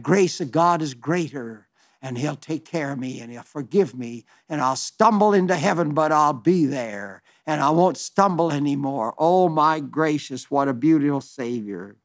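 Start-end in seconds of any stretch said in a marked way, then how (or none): none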